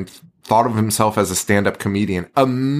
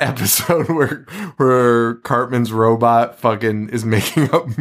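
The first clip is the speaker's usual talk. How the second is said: ordinary talk, nothing marked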